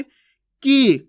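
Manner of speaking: की with a long, loud "ee"; drawn-out vowel. Shown as a demonstration. Teacher modelling long ee matra, drawn out. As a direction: stretch की out, pitched high. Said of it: "खींच के करेंगे".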